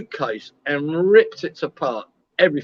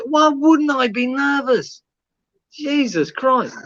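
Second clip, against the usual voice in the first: higher, drawn-out exasperated comeback; exasperated retort; 5 semitones higher, slower than usual. Retold or imitated. Traveller retelling his retort to a customs officer, exasperated. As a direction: stretch out the indignant words in disbelief.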